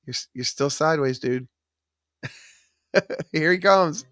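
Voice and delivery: usual speaking voice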